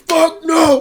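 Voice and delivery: high, loud mock scream; indignant shouted imitation; screamed refusal. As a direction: imitate a big guy yelling his refusal. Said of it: "He's screaming".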